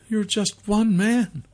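high, slow woman's voice; high-pitched woman, doubtful; doubting young mother. A narrator's reading.

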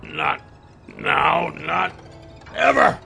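strained, high defiance building to the last word; through clenched teeth. A narrator's reading. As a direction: push it out in broken, effortful bursts. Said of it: "through clenched teeth".

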